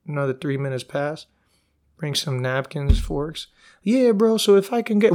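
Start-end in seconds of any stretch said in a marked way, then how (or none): 3.8-5.1 high, chummy waiter voice, raised; eager waiter. Retold or imitated. Narrator mocking a resort waiter, high and chummy. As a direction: play the overfriendly bro waiter.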